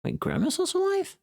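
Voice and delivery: sudden high-pitched surprise; catching sight of something unexpected; real startled jump up in pitch; surprised. Real, not acted. Spots a streamer unexpectedly live.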